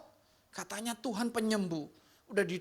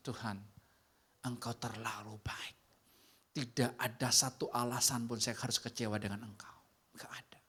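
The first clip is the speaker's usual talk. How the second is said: low, hushed whisper; an intimate, reverent whisper to God; whispered prayer; 9 semitones lower, 4 dB quieter than usual. Retold or imitated. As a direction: breathe the prayer almost silently. Whispered prayer in retold story.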